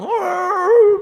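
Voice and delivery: loud, high mock wail; hammed-up whining cry, very high and loud; mock whiny wail. Preacher imitates someone whining in prayer, loud high wail. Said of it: "뭐만 하면 징징대".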